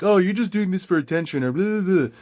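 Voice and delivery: mock the troll's whine lightly; a hint of a snide internet troll. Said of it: "That's the voice I hear when people troll online".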